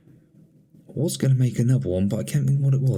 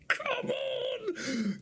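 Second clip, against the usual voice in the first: much higher, thrilled cry of joy; ecstatic cry; 12 dB quieter than usual. Real, not acted. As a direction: cry out as the ball goes in. Celebrating his team's goal.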